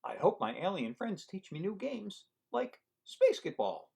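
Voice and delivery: lively storybook read-aloud; reading to children. Acted. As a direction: read it to a room of kids. Reading picture book aloud.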